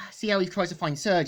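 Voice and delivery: ordinary talk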